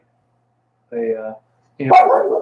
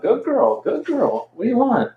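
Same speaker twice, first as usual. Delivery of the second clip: high-pitched sing-song praise for the dog; high pet talk; 8 semitones higher than usual. Real, not acted. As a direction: coo up high like talking to a puppy. Cooing at the dog.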